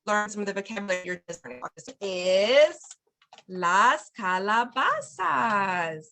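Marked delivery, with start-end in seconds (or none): 3.5-6.0 very slow, drawn-out "las calabazas"; slow teaching model. Shown as a demonstration. Teacher introducing the word of the week, slow and drawn out. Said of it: "you can repeat las".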